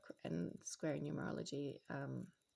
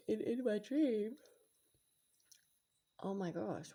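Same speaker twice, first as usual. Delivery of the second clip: normal talking voice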